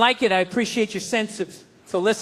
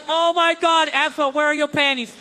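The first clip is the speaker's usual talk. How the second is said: shocked teacher; 6 semitones higher, 4 dB louder than usual. Retold or imitated. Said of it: "the teacher's like".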